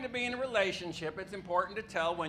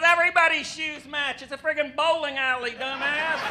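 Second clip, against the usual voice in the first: loud, higher, mocking retort; scornful put-down; 8 semitones higher, 7 dB louder than usual. Girl at the bowling alley mocking his pickup line, loud scornful put-down. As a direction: slap him down with contempt.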